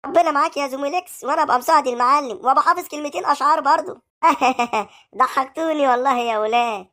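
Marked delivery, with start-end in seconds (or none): 4.2-4.8 short amused chuckle; hearty laugh. Acted. The boss laughs at his men's joke, short chuckle. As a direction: laugh at the boys' boasting.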